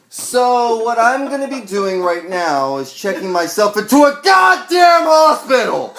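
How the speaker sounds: shouting, building to a full yell down the phone; furious voicemail rant. Retold or imitated. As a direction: scream it like you've lost it. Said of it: "This is how I said it verbatim".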